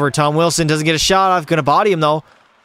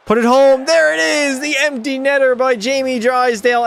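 excited call; 9 semitones higher than usual. Real, not acted. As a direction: shout it like a commentator. Empty net goal seals win.

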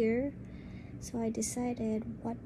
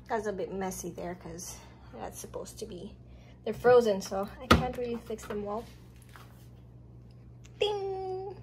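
normal talking voice

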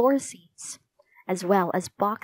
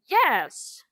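shouted yes; 4 semitones higher than usual. A narrator's reading. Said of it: "suddenly he shouted".

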